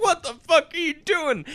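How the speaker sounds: high-pitched mock disbelief; acted high squawk of alarm; incredulous outburst. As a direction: protest it high, like a startled boat owner. Boat owner protests in retold film.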